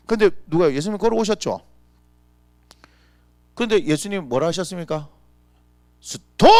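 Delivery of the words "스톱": loud command. Acted. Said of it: "이렇게 하지 않았습니다".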